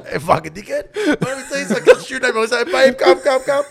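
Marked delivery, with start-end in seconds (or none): 0.1-2.4 loud, high, laughing protest; heated laughing outburst. Real, not acted. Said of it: "You didn't try to help her out". 2.6-3.6 loud retold call. Retold calling girlfriend to him.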